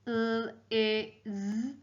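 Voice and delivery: "L, I, Z" as drawn-out phonics sounds; sounding out letters. Shown as a demonstration. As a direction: spell "L, I, Z" by sound, one at a time. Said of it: "So I'll spell it all together".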